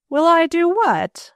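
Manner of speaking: high, slow, incredulous question; shocked demand. A narrator's reading. Mother startled by the engagement request, demanding. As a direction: demand it as if you can't believe your ears. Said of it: "she demanded".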